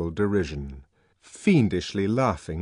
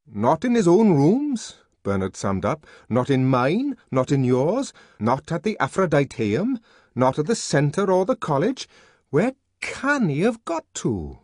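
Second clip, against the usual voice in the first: higher, louder listing; exasperated; 7 semitones higher, 5 dB louder than usual. A narrator's reading. Bernard summing up where the savage is not, exasperated. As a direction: count off each place, annoyed.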